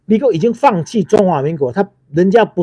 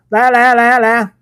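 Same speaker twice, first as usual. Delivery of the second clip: loud, higher goading dare, come on come on; taunting call; 4 semitones higher, 4 dB louder than usual. Real, not acted. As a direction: challenge them to step up and argue. Daring the DPP to come out. (大声挑衅地喊来啊)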